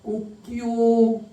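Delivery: drawn-out imitated cock-crow; rooster crow. Preacher imitates the rooster after Peter's denial, long drawn-out crow. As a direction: stretch it out slowly like a crowing rooster. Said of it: "두 번의 소리가 납니다".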